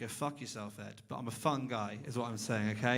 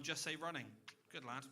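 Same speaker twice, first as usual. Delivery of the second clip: usual speaking voice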